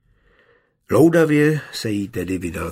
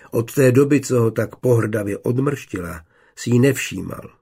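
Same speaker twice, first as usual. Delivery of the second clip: ordinary delivery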